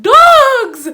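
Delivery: very high, loud yelp of alarm; shocked shout. Retold or imitated. Told dogs were released, she yelps in shock. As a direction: yelp the word in horror.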